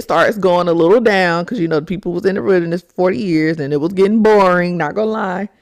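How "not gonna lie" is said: ordinary talk, nothing marked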